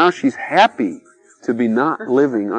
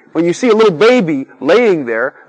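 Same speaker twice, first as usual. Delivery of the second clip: normal talking voice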